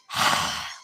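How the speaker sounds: a long, put-upon exhale; dog's sigh, voiced by its owner; passive aggressive sigh. Shown as a demonstration. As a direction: sigh like a bored, sulking dog. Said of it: "super passive aggressive sigh".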